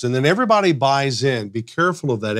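normal talking voice

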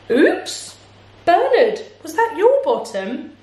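high-pitched, apologetic bird; bird character. A narrator's reading. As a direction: start on a very high chirpy oops. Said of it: "said Bird".

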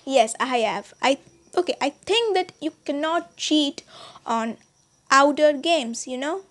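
ordinary talk, nothing marked

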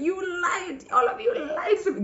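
high, mock-accusing squeal; playful accusation. Bride-to-be teasing her girlfriends, high playful accusation. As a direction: tease them for the lie.

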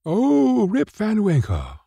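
high-pitched exclamation of recognition; high, ready exclamation; exclaiming. A narrator's reading. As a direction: exclaim it high, as villagers in a crowd. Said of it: "exclaimed two or three".

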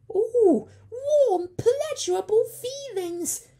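very high, loud, delighted squeaky creature; happy creature. A narrator's reading. Creature delighted with his new job, very high. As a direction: swoon with pleasure. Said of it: "happy as he could be".

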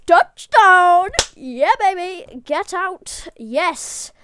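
huge, high, loud victory yell; triumphant shout. Real, not acted. Gamer celebrating touchdown, triumphant shouting. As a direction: explode with joy like a commentator at a score.

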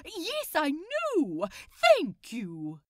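loud, slow indignant agreement; finally someone agrees; emphatic vindicated outburst. Acted.

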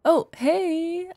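bright startled greeting; surprised hey. Acted. She finds him in the kitchen, surprised. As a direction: caught off guard, calling out to him.

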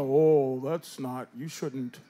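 low, subdued murmur of disapproval; self-policing men. Acted. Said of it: "Men go".

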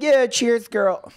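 high, drawn-out mocking girl voice; imitating a tipsy-style bar toast; mock party girl. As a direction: put on a high-pitched girly cheer.

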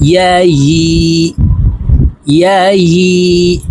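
measured sing-song letter recitation, long final vowels; Quranic spelling drill. Shown as a demonstration. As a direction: chant each spelling for pupils to repeat. (সুর করে টেনে টেনে কায়দার বানান মশক)

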